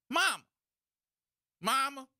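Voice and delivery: high, loud call of mama, mama; hammed-up child yelling for mom; mock yell. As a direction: shout at your mom to stop. Student yelling at own mom.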